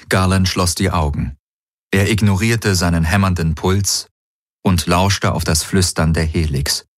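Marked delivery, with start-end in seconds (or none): none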